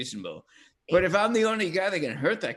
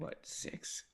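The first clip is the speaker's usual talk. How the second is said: soft unvoiced whisper; 20 dB quieter than usual. Real, not acted. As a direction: hush it like a secret. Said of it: "I love that you're whispering".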